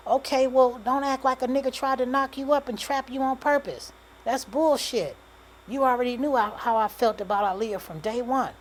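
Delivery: high, loud outburst; heated anger. A narrator's reading. Man denying he trapped her on purpose, heated anger. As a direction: argue back hard, voice up. Man argues back angrily.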